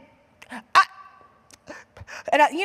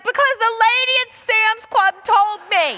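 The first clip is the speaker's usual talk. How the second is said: 1 insisting loudly; 9 semitones higher, 6 dB louder than usual. Retold or imitated. Arguing with husband about bag.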